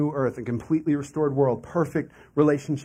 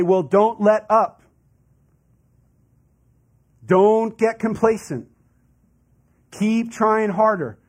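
louder, slow, stern push; demanding authority; 4 semitones higher, 5 dB louder, slower than usual. Retold or imitated. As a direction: lecture them never to rest. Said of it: "they'd say".

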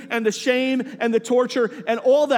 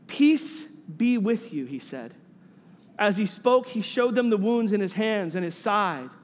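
scripture reading; 3 semitones lower, 3 dB quieter than usual. Acted. Reads the scripture passage aloud.

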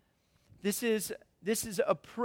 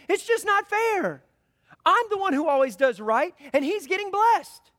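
high, louder mock whine; whiny complaint; 8 semitones higher, 5 dB louder than usual. Preacher mocks a worker's jealous prayer, whining high. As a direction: play a sulky employee feeling cheated.

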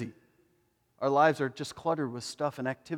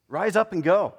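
call to rise; 4 semitones higher, 6 dB louder than usual. Pretend. Preacher calls out command.

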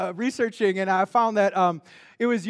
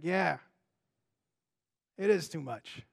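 gentle agreement; 6 semitones lower, 7 dB quieter than usual. Pretend. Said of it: "He's like".

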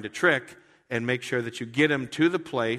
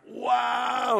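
higher, loud amazed wow; awed wow; 13 semitones higher, 5 dB louder than usual. Retold or imitated. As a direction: gasp it out in awe. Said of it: "be like".

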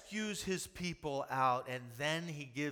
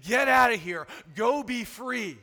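loud dismissal; 7 semitones higher, 12 dB louder than usual. Retold or imitated. Pharaoh to the Israelites, a loud driving-out shout. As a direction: shout them out the door.

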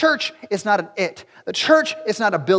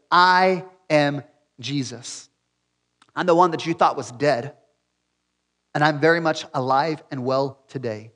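solemn divine voice; 6 semitones lower than usual. Acted. Voicing Jesus revealing himself to Saul.

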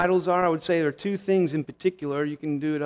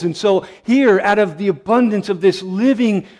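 louder, fervent preaching of God's words; 3 semitones higher, 5 dB louder than usual. Acted. Preacher proclaiming God's promises to the congregation, fervent and louder. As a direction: lift it into full pulpit proclamation.

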